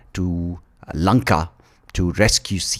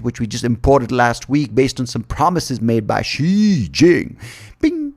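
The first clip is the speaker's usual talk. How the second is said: lightly acted high-pitched bro protest; a hint of a pleading dude, high and emphatic; ten-headed dude; 7 semitones higher, 3 dB louder than usual. Demon king refusing Sita a night out, mock protesting dude. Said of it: "the ten-headed dude is like".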